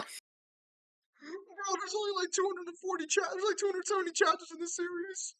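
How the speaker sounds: exasperated. Real, not acted. Exasperated high complaint.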